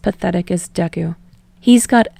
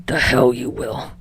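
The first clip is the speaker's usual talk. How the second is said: snarled; 7 semitones lower than usual. Acted. Bakugo answering Deku's call, low snarl.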